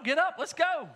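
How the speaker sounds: put-on a touch of a parent rousing teens; mock parent nagging. Nagging his teenagers.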